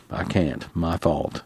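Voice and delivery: very soft, defeated self-blame; quietly beaten, barely offering the words; dejected. A narrator's reading. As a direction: let the voice sink away in guilt. Father overcome by guilt.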